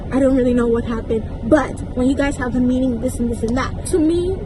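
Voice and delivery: ordinary delivery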